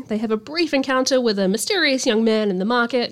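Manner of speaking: ordinary talk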